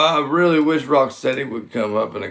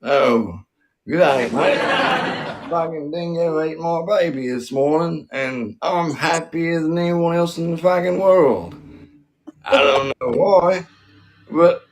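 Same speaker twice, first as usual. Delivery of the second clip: hammed-up Aussie accent, pitched up; full Aussie accent; 5 semitones higher than usual. Comic joking a dingo ate my baby, full mock Aussie accent. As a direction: go broad, over-the-top Australian. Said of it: "full, full Aussie".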